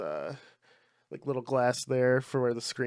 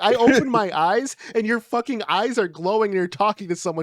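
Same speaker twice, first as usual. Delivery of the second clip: high, loud mock freak-out; spooked wife; 6 semitones higher, 6 dB louder than usual. Wife frightened by husband's glowing eyes, exaggerated spooked voice. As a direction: retell the fright, pitched up and emphatic.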